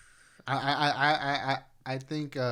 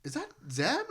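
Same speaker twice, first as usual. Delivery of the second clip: surprised question; 10 semitones higher than usual. Retold or imitated. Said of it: "I'm like".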